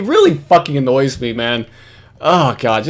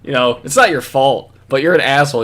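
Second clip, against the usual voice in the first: usual speaking voice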